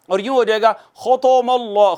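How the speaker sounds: ordinary talk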